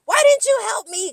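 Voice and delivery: high, loud mimicked outburst; imitated yell. Dorit demanding help from Kyle, imitated yelling. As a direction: shout it way up high as a retold demand. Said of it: "when Dorit was yelling at Kyle".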